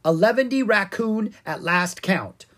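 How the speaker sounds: high, loud, proud proclamation; proud announcement. A narrator's reading. Pumpkin King reports his pie count, proudly. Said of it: "the Pumpkin King proudly announced".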